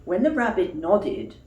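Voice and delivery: usual speaking voice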